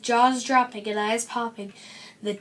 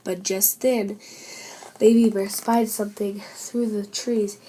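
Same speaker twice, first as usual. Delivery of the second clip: ordinary delivery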